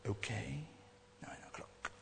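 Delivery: put-on a teen mumbling agreement; meek teenager. Teenager agreeing to dad's curfew, meek whisper.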